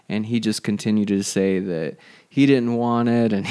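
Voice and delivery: ordinary delivery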